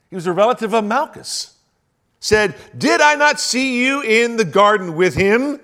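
loud, high, driving sermon voice; full-force preaching. Acted. Pastor builds to the accusation, preaching loudly.

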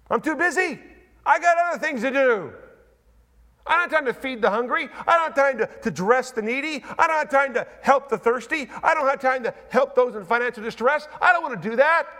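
lightly acted grumbling, rising with each excuse; griping excuse-maker. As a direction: ham up a busy churchgoer who won't help. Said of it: "Griping because it takes time".

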